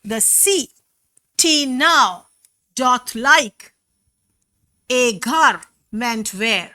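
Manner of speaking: slow scansion of "The city now doth like a garment wear", beat by beat; teacher slowly marking every second syllable in "The city now doth like a garment wear"; scanning iambic stress. Shown as a demonstration. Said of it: "the stress is on the second syllable".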